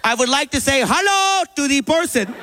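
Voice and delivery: stalk in like a falcon, demanding names; mom demanding. Retold or imitated. Mom swoops in on the kid in the sprinklers, menacing. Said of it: "She's like".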